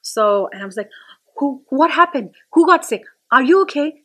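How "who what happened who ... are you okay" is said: worried, higher and louder questions; panicked mother checking on her son; alarmed mom. Retold or imitated. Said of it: "I almost jumped".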